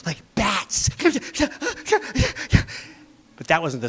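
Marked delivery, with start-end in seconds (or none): none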